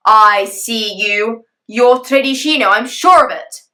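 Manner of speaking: slow, loud dragon accusation; dragon shout. Acted. Father dragon catches the thief running off, loud and slow. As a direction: call him out in the dark.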